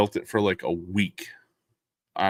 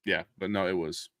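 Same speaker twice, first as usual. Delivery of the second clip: normal talking voice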